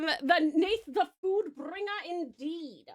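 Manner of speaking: slow, high, grand proclamation; drawn-out regal flourish, falling at the end; playful queen. Acted. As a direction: declare the servant's title with mock pomp. Still in queen act, naming servant.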